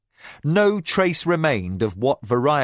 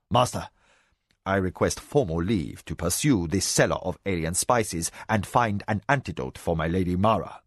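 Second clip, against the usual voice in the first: formal request; 5 semitones lower than usual. A narrator's reading. Kneeling spymaster formally asking leave.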